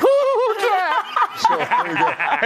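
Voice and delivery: high, squealing fangirl imitation. Imitating a fan meeting Kat, high and squealing. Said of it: "you sounded just like him".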